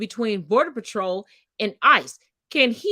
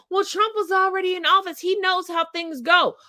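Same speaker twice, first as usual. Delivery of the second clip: higher, smug mock voice; mock naive voter; 7 semitones higher than usual. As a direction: parody the clueless voter's excuse. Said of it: "you're sitting there going".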